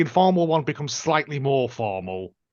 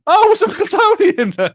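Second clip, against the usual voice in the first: high, loud startled question; a burst of real surprise; suddenly surprised, much higher and louder; 9 dB louder than usual. Real, not acted. Surprised at mention.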